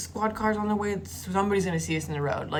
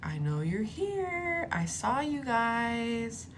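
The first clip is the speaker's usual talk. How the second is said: high, slow, drawn-out taunt; creepy stalker; 6 semitones higher, much slower than usual. Retold or imitated. Stalker calling to hidden girls in woods, creepy taunt. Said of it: "saying some weird stuff like".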